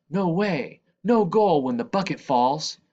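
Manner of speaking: put-on boy shouting a protest at the goal. A narrator's reading. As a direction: shout it as you jump down. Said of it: "Badu jumps down and shouts".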